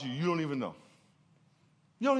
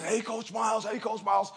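put-on higher-pitched kid calling his coach; raised pitch, imitated youngster's call; imitated kid player; 6 semitones higher than usual. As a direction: mimic an eager kid greeting his coach. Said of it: "he talked like this".

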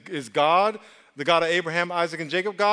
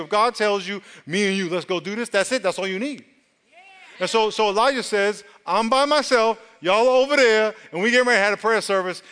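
preaching at full force; 4 semitones higher than usual. Acted. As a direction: preach it hard to the room.

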